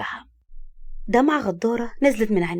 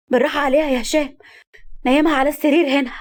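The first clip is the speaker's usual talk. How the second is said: higher and louder, urgent with fear; a scared shout while helping carry her; frightened cry; 5 semitones higher, 4 dB louder than usual. A narrator's reading. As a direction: plead loudly for him to be careful. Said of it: "سعاد صرخت بخوف".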